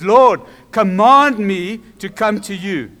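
loud, slow, emphatic appeal; preacher voicing Peter's bold call; bold demand. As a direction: press each word home as a daring request. Peter's bold request, repeated for emphasis.

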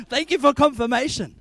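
loud ironic gratitude; sarcastic shouted thanks. Retold or imitated. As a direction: shout thanks with mock irony.

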